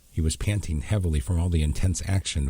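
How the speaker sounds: plain reading voice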